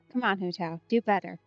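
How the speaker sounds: ordinary talk, nothing marked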